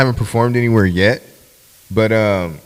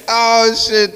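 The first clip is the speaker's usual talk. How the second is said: higher mock startled cry; surprised; 11 semitones higher, 3 dB louder than usual. A friend reacting to the story, surprised cry. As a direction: gasp it out in shock. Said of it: "He said".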